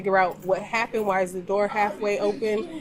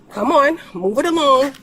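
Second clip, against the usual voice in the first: loud, raised, hurrying command; re-enacted impatient order; 5 semitones higher, 9 dB louder than usual. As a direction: act out hustling them out of the house. Mother retells ordering couple out.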